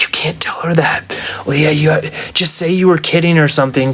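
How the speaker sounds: hushed whispered aside, acted out; retold secretive whisper to a sibling; urgent whisper. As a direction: whisper it so she won't overhear.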